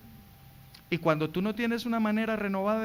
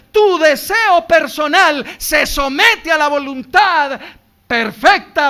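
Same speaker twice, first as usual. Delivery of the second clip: loud, higher preaching; preaching at full force; 8 semitones higher, 14 dB louder, slower than usual. Acted. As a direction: drive it home to the congregation. (predicación a todo pulmón)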